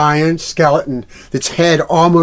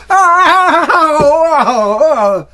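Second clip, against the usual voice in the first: much higher, loud shivering yelps; shocked cold gasps; 8 dB louder, much slower than usual. Acted. Silly yelping as ice is dumped on his head. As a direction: yelp from the icy shock. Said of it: "Silly went".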